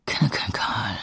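hiding, unvoiced whisper. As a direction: whisper so no one outside hears.

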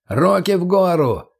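high, drawn-out command; booming shout. A narrator's reading. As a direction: call it out slowly as a raid order. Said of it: "прогремел громкий крик".

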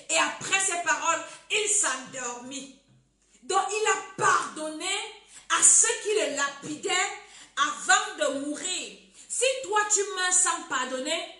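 loud, deliberate sermon delivery; preaching at full force. Acted. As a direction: drive each phrase home to the congregation. (prédication appuyée, voix forte)

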